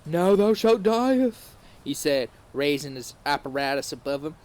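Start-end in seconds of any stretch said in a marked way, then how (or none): 0.0-1.5 high, loud, slow hammed-up villain; sexy Voldemort. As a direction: savor the threat slowly, higher and louder. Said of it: "so sexily I nearly wet myself".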